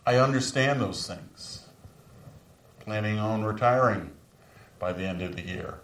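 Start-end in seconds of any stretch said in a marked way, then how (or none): none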